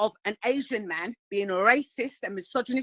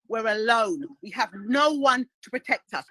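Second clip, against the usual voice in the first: usual speaking voice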